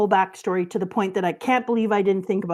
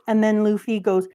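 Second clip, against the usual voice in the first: usual speaking voice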